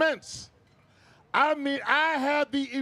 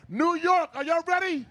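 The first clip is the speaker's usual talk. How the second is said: warm-up call; 4 semitones higher than usual. Real, not acted. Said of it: "I need to get fired up".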